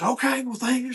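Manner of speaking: relieved thanks. Retold or imitated. Mother relieved, quoted.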